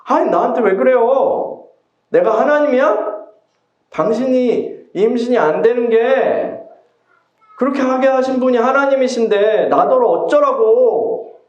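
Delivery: husband flares up at his pleading wife; flaring up. Acted. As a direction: snap back at her, fed up. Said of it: "야곱은 버럭 화를 내면서 말합니다".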